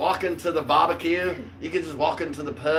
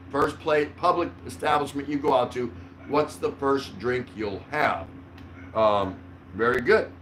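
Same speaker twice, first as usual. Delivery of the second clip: normal talking voice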